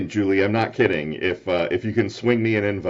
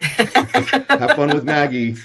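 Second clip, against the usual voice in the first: laughing; 7 semitones higher, 5 dB louder, much slower than usual. Real, not acted. Laughing teasing.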